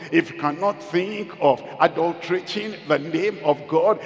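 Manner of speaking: normal talking voice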